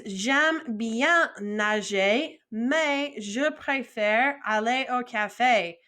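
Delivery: very slow, syllable by syllable French; slow model sentence. Shown as a demonstration. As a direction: spell it out slowly for beginners. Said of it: "So the next one is".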